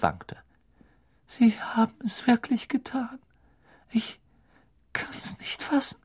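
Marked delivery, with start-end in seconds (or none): 1.3-6.0 hohes, gehauchtes Flüstern; flüstere erschüttert; fassungslos. A narrator's reading. Hermine shocked. (Hermine flüstert entsetzt nach dem Axtschlag)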